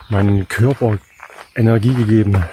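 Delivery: ordinary delivery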